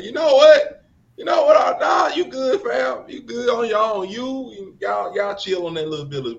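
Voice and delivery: high, loud mock brush-off; hammed-up high voice waving someone off; mocking Navy voice. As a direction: shout it like a dismissive officer.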